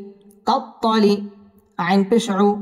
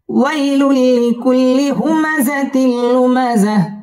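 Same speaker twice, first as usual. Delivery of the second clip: slow, chanted tajweed recitation, high and drawn out; Quran recitation; 5 semitones higher, much slower than usual. Shown as a demonstration. Teacher reciting Surah Humazah for students, slow chanted tajweed. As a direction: intone each verse slowly with long held vowels.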